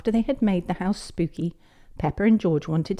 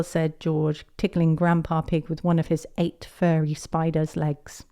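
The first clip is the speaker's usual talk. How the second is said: ordinary delivery, nothing marked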